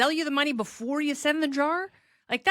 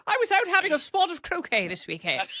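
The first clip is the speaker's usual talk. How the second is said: higher, hammed-up fake British accent; 5 semitones higher than usual. Host mocking Stuart Varney's fake British accent, hammed up. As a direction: play up a put-on posh British voice. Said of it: "Stewart say it in his fake accent".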